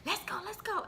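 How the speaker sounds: high, excited let's go; hyped. Retold or imitated. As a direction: cheer it out high. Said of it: "he got me hyped".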